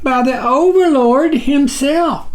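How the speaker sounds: high squeak, deferential; tiny creature's high, timid squeak; squeaky little voice. A narrator's reading. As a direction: squeak it out politely to the giant. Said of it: "His voice was not much more than a squeak".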